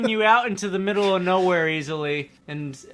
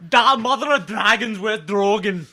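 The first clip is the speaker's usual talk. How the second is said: a clumsy Arnold-Scottish mix; Arnold accent; 4 semitones higher, 3 dB louder than usual. Pretend. Friends riffing on Game of Thrones, a mock Schwarzenegger accent. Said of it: "that was like English Scottish".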